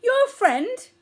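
high, loud, outraged question; indignant snort. A narrator's reading. Mother elephant scolding her child about a friend, indignant snort. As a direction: snort it in shrill disbelief. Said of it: "snorted Omar".

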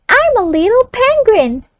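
put-on high child voice echoing back. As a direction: echo it like a young pupil. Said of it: "the child reads it back".

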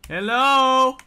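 loud, high, stretched hail; calling out. Real, not acted. Gamer testing how to talk to players, loud call. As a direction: call out to be heard. Testing whether voice chat works.

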